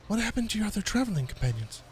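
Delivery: lightly voiced high halfling; [name]'s voice. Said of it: "he says".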